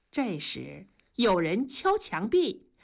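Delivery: plain reading voice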